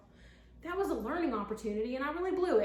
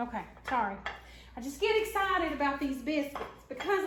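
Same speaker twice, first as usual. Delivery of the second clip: ordinary delivery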